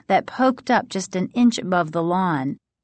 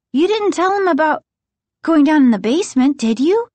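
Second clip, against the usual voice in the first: high, fast, louder, worried questioning; anxious question; 9 semitones higher, 6 dB louder, faster than usual. A narrator's reading. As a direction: blurt it out, alarmed and pleading for a no. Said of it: "Margaret asked anxiously".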